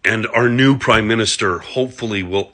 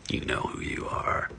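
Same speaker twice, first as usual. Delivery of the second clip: whisper; 15 dB quieter than usual. Acted. Taunting unnamed rival in whisper.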